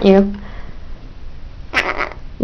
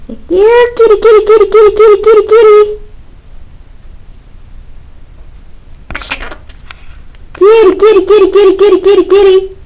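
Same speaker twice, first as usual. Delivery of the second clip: high sing-song kitty call; 10 semitones higher, faster than usual. Acted. Calling like a bird would.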